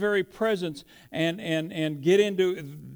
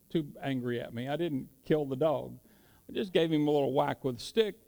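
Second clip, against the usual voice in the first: ordinary talk, nothing marked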